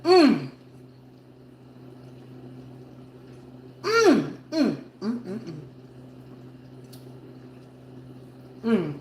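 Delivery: savoring mouthfuls with hums; messy loud eating. Real, not acted. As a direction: mouth full, humming while chewing. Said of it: "talk with my mouth full".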